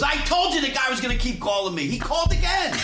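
high, rising mock indignation; aggrieved outburst. Prank victim complaining to cousins next morning, aggrieved outburst. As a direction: imitate a flustered man venting, pitch climbing.

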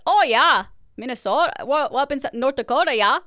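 put-on thick, high-pitched Upper Midwest accent; Fargo accent. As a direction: exaggerate a Minnesota-North Dakota accent. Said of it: "because of their accents".

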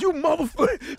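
high, mock-furious snap; retold angry outburst. Joe lashing out at Cap, mock-angry high snap. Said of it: "Joe goes".

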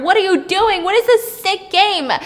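high, loud panicked cries. Retold or imitated. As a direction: cry out in horror. Players' horror after the massacre.

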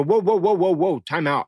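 high, insistent interjection; urgent halt. Retold or imitated. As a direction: stop everyone in their tracks. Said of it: "And I go".

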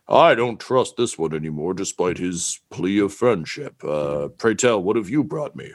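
lightly voiced shopkeeper, measured and deliberate; a touch of a big shopkeeper's voice; wary shopkeeper. Shopkeeper turning to another visitor, lightly voiced and wary. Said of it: "he just says".

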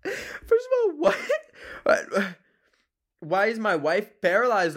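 high-pitched shocked outcry; shocked disbelief. Real, not acted. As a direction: react like the question is outrageous. Shocked at paralyzed wife question.

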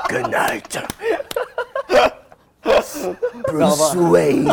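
gruff whispered superhero line; Batman voice. Acted. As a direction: play it like a brooding caped hero. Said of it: "I'm like Batman or the Joker".

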